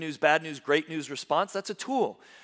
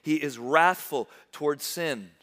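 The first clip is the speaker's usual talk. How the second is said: usual speaking voice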